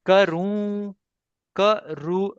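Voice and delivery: karu split slowly, second syllable held high into the nose; nasal ending demo. Shown as a demonstration. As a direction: model karu drawn out and sustained. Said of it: "not na".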